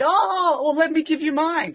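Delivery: high, lilting imitation of an eager giver; retold eager offer. Church people offer their booklet, imitated eager high voice. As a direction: pipe up high, imitating someone keen to share.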